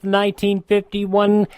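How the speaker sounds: ordinary talk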